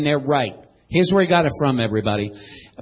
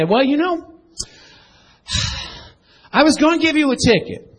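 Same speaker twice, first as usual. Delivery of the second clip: officer voice; 8 semitones higher than usual. Acted. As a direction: play the cop pulling you over. Preacher acts out police officer.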